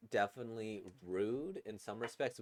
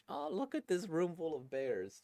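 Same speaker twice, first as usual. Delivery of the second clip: high-pitched delighted coo; cutesy gushing; 6 semitones higher than usual. Real, not acted. Reviewer admiring a room full of bears, cooing high. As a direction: coo adoringly in a raised, cutesy voice.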